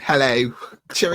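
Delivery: hammed-up English accent. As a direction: play up a cheery English greeting. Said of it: "find out he's from England".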